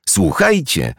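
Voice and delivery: high-pitched eager call; lively summons; calling for attention. A narrator's reading. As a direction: get the friends' attention, voice up. Jerzy interrupts, calls the others.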